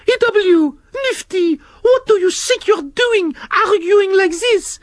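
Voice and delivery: very high, prim scolding snail voice; Shelley the snail scolding the worm and slug, very high prim voice; French snail Shelley. Acted. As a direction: tell off two squabbling children.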